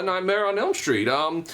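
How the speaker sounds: ordinary delivery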